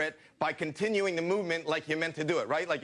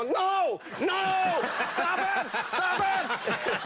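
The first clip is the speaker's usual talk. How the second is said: panicked riders' scream; much slower than usual. Retold or imitated. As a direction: shriek like terrified riders. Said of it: "And people go".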